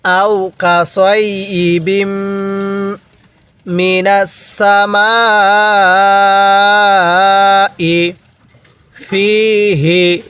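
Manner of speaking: slow Quranic recitation with long-held vowels; measured reciting, pauses between phrases; model recitation. Shown as a demonstration. Teacher leading learners to read along, slow recitation. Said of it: "শুরু থেকে একবার আমার সাথে সাথে পড়বেন".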